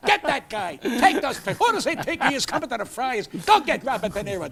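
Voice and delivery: loud, high mock command to the crowd; playful yelling at the room; mock shouted order. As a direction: bark it like a heckling emcee. Roasting De Niro at the Friars.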